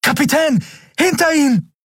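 very high, alarmed call to the captain; urgent warning shout. A narrator's reading. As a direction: yell the warning before the shot. Boy warns captain mid-battle. (sehr hoher, alarmierter Warnruf)

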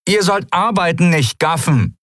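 high-pitched scolding shout; calling out. A narrator's reading. Pirate woman to gawking companions, shouting reprovingly. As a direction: yell at slackers to get working. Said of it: "rief die Menschenfrau mit dem Apfel ihren Kumpanen zu".

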